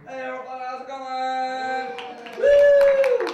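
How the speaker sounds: sung-out, drawn-out high hello with a whoop; loud greeting. Real, not acted. Comic greets the crowd on taking the stage, loud and sung-out. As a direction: belt the greeting, stretching the vowels.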